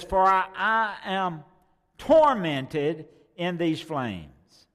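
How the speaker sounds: loud and very slow, drawn out in agony; anguished cry. Acted. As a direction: groan it from the fire. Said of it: "He says".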